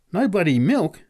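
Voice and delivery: loud, high exclamation of disbelief; astonished, pitch shooting up; exclaiming. A narrator's reading. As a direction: exclaim it incredulously. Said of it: "exclaimed the man".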